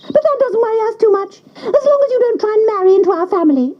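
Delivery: high, fast, cackling duck voice; wild ducks teasing. A narrator's reading. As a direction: rattle it off like a cheeky wild duck. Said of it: "said the wild ducks".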